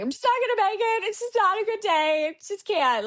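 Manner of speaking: high, rushed mock-tearful whine; imitated crying. Giving up in a message to her friend, imitated crying. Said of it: "just crying".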